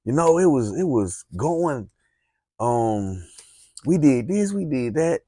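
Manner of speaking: high, lively greeting to a friend; animated. Shown as a demonstration. Showing how to greet a friend, animated and high. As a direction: bounce it up like meeting a buddy. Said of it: "You wanna be animated".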